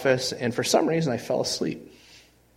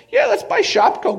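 sudden much higher, louder, breezy yelp; mock-cocky confidence, pitched way up; carefree bravado; 6 dB louder than usual. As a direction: toss it off like it's nothing. Mocking his own bold confidence.

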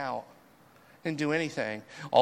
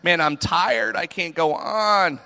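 louder, higher, slow and worn out; weary venting; 5 semitones higher, 4 dB louder, slower than usual. Acted. Elijah venting to God, slow and worn out. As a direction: drag the words out, exhausted. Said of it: "He vents".

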